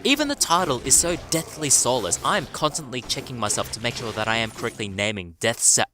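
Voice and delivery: normal talking voice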